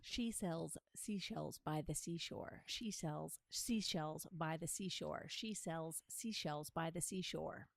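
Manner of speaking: slow deliberate articulation; slow practice of the twister; slow tongue twister. Shown as a demonstration. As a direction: carefully enunciate each S. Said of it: "S's are my nemesis".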